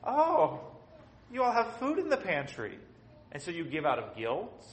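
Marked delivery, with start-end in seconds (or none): 0.0-2.8 covetous guest. Retold or imitated. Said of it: "somebody comes into your house and goes".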